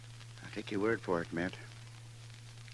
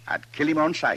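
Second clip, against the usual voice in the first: angry; 6 semitones higher, 9 dB louder than usual. Acted. Angry about an outlaw.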